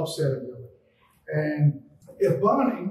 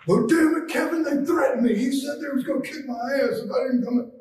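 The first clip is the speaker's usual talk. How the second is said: worked-up complaint; 9 semitones higher, 5 dB louder than usual. Retold or imitated. Ricky complaining about threats.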